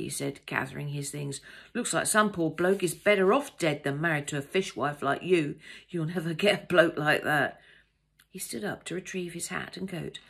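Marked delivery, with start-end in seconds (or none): 1.7-7.6 loud parting jibe from an offended traveller; insulting male passenger. A narrator's reading. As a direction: throw insults at her, louder.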